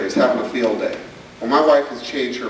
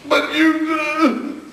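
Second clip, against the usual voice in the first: high whiny "But you"; high, sulky child protest; 12 semitones higher than usual. Retold or imitated. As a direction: cut in like a child objecting.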